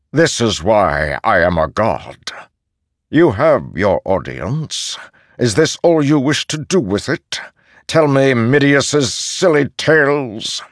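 lofty, scornful ancient god; proud god voice. Acted. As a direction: speak down like a weary deity. Ishar's voice in audiobook.

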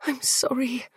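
soft, high whispered apology; anguished whisper. A narrator's reading. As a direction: whisper helplessly to someone in pain. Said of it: "she whispered, anguished".

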